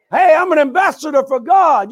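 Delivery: loud, high, emphatic proclamation; proud declaration. Shown as a demonstration. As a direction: proclaim it like you mean it. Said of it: "say it like you mean it".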